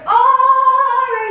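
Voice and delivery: very high, loud sung "oh"; big high sung oh; huge surprise. Shown as a demonstration. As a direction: let a huge breath burst out on oh. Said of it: "A huge breath, and it's like".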